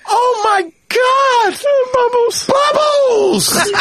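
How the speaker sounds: very high, slow shrieking cry; Michael shrieking Bubbles; high scream. Retold or imitated. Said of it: "And Michael screams".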